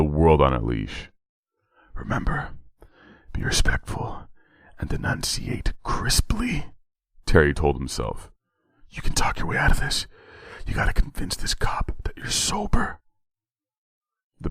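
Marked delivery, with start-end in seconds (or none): 1.9-6.7 inner whisper. A narrator's reading. Nervous driver coaching himself before the cop: whispered. Terry's whispered self-talk. 8.9-13.0 urgent whispered self-talk; inner whisper. A narrator's reading. Terry psyching himself up in the car: whispered.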